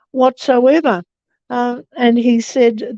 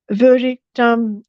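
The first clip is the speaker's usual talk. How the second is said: ordinary talk, nothing marked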